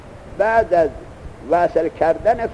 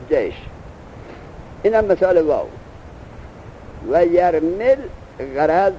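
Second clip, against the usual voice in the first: usual speaking voice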